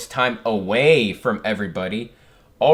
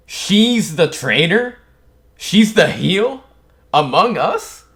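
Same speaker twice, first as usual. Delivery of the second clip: stunned surprise; 6 semitones higher, 7 dB louder, slower than usual. Real, not acted. Reacting to the traitor reveal.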